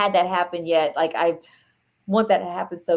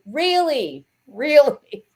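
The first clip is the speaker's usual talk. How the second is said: 1 mock disapproval; 7 semitones higher, 4 dB louder, much slower than usual. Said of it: "And I go".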